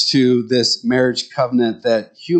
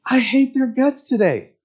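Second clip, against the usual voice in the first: acted exasperation; 11 semitones higher than usual. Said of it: "you look at them and say".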